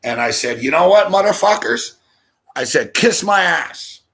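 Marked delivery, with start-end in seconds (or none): 0.6-1.9 loud, high challenge to the whole table; defiant shout. Retold or imitated. Retold showdown with the uncles. 2.9-4.0 high, drawn-out insult to the room; defiant retort. Retold or imitated. Retold showdown with the uncles.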